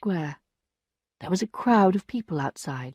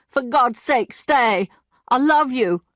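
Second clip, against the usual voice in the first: 1 pleading shout; 5 semitones higher, 4 dB louder, slower than usual. A narrator's reading.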